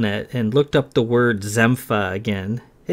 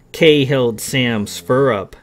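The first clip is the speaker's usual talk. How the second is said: ordinary delivery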